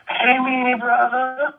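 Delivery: high, slow imitation of a thick Southern drawl; mock a big Southern guy greeting you, high and drawn out; hammed-up Southern accent greeting. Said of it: "thick Southern accent".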